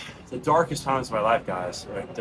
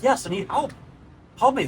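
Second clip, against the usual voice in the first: much higher, louder plea from under the water; desperate cry for help; 10 semitones higher, 3 dB louder than usual. Retold or imitated. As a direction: call out like someone finally heard. Drowning person calls for help.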